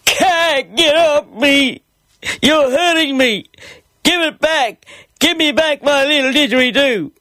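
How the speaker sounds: put-on very high, distressed child voice; hammed-up, high-pitched pleading cry. A narrator's reading. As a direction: wail in protest like a little kid under attack. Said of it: "I cried out".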